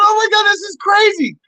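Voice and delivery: high excited exclamation; retold amazement. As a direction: blurt it way up high, reliving the thrill. Billboard reaction, acted out.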